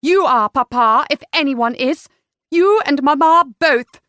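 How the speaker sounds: loud, indignant retort; fed up. A narrator's reading. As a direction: throw the blame back hotly. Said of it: "now she had had enough".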